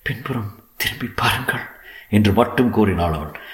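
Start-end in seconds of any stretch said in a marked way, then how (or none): 0.0-1.6 hushed, wavering warning; a quiet, halting warning to the listener; faltering voice. A narrator's reading. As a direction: softly, voice unsteady. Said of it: "குரல் மெல்ல ஆட்டம் கண்டிருந்தது".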